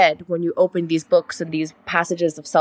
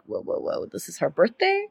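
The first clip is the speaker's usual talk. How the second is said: whispered, far lower, softer dad voice; hushed dad; 3 semitones lower, 8 dB quieter than usual. Retold or imitated. Dad stepping in to pay, in a hushed whisper. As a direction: breathe it like a startled dad.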